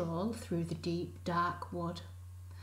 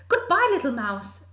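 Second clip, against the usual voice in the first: higher, louder hurried goodbye; fleeing fox; 7 semitones higher, 10 dB louder, faster than usual. Acted. Fox calling goodbye while running off, louder. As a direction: call it over your shoulder.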